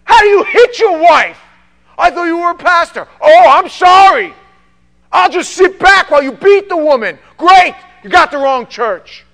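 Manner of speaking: very loud, high sarcastic yelling; furious sarcastic shouting. Retold or imitated. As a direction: lash back at the abuser. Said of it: "And I go".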